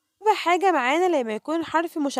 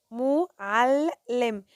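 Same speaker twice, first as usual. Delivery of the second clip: "معلم" very slowly, ل closing one syllable and opening the next; drawn-out teacher's split of "معلم"; much slower than usual. Shown as a demonstration. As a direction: break "معلم" into three parts with pauses. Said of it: "نطقت هنا عندي اللام مرتين".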